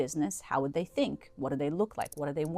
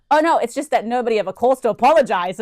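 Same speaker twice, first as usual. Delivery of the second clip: higher, louder voice of a taken-aback staffer; imitated surprise; 7 semitones higher, 12 dB louder than usual. Staff member answering an apology call, imitated surprise. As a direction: mimic someone thrown by an unexpected apology.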